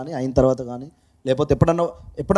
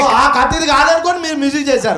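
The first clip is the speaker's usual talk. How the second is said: teasing through laughter; real laughter in playful banter; laughing; 6 semitones higher, 4 dB louder than usual. Real, not acted. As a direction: laugh while teasing, pitch up high.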